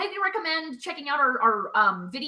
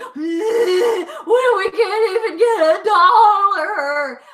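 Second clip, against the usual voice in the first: high, loud mock wail; hammed-up panicked whine, stretched into a long cry; anxiety fit; 6 semitones higher, 6 dB louder, much slower than usual. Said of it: "a weird anxiety fit".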